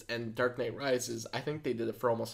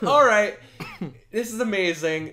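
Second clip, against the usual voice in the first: loud, high burst of amazement; excited reaction; 8 semitones higher, 9 dB louder than usual. Retold or imitated. Fan retelling the semi flip moment, excited outburst. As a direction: re-enact being thrilled, punching it out loud and high.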